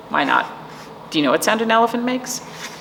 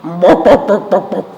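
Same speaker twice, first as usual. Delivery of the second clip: dog bark; 6 dB louder than usual. Acted. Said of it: "Let me try that dog again".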